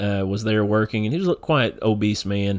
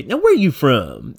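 put-on country accent; 5 semitones higher, 4 dB louder, slower than usual. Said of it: "somebody with a little bit of a southern accent".

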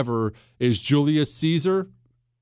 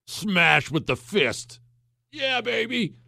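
mock macho gusto; 5 semitones higher, slower than usual. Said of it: "you're like".

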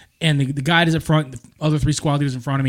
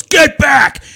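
sudden loud shout at the squirrel, higher; 11 semitones higher, 7 dB louder than usual. Retold or imitated. Recruit yelling at a squirrel crossing the platoon, a loud shout. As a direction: yell get back at a squirrel.

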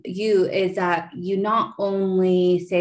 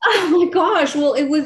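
laughing; 8 semitones higher, 6 dB louder than usual. Real, not acted. Emotional reaction to her story.

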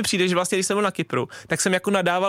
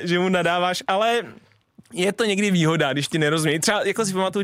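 usual speaking voice

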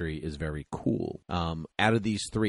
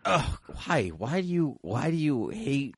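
exasperated whine; 6 semitones higher than usual. Real, not acted. Friend calls [name] overrated.

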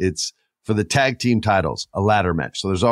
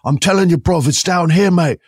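put-on higher, louder rough British accent; 10 semitones higher, 6 dB louder than usual. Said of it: "is a rough British".

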